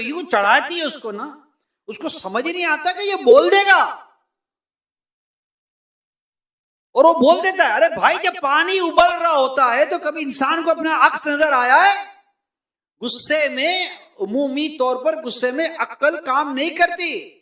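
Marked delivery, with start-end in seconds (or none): 1.9-12.2 louder, higher impassioned preaching; fiery preaching. Real, not acted. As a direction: drive the point home to the crowd. Preaching at full force.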